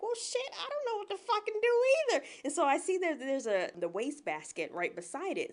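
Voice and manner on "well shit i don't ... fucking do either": high, put-on fluster; hammed-up helplessness without shouting; mock helpless panic. Woman answering the panicking doctor, mock helpless.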